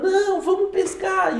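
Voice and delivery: high insistent mock Peter; Peter insisting. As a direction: raise the pitch, insist on fishing. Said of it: "ali Pedro tá falando".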